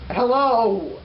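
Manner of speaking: greeting everyone at the skate park; loud greeting. Retold or imitated. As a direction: call out across the skate park. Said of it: "and I say".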